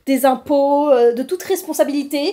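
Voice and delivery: ordinary talk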